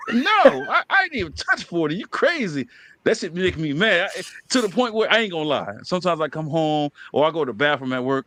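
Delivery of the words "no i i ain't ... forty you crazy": denying being forty-eight, indignant; indignant denial. Retold or imitated. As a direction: protest it with disbelief. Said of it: "I'm like".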